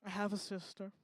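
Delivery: softly mocked posh reluctance; understated, quiet posh put-on voice; posh sister. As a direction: imitate a grand sibling admitting it grudgingly. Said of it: "she's exceedingly posh".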